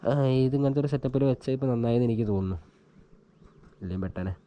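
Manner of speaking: usual speaking voice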